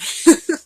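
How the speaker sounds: bright burst of laughter; quick giggle; short high laugh. Real, not acted. Laughs after rambling.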